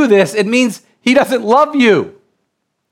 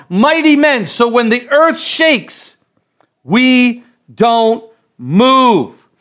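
fired-up preaching; 4 semitones higher than usual. Acted. Preacher urging the church through tough times, rising to a shout. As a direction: drive it home to the congregation.

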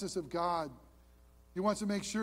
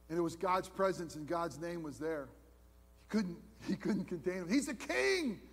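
ordinary talk

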